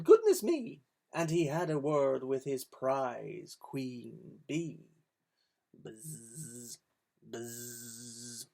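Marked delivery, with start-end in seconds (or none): 0.0-0.8 high, loud surprised cry; startled farmer. A narrator's reading. Farmer waking startled by the racket. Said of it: "The farmer woke and said". 5.7-8.5 buzzing bee. Acted. Bee sound in story.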